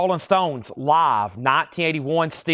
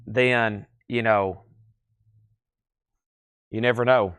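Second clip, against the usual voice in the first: normal talking voice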